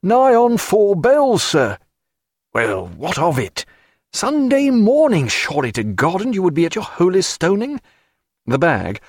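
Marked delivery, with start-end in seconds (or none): 0.0-1.8 high, slow drawn-out seaman; high drawling sailor; sailor coaxing. A narrator's reading. Sailor pressing the doctor. 2.5-7.8 a hint of a whining sleeper, jumping higher; lightly voiced grumbling doctor. A narrator's reading. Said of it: "the whining tone of a man jerked".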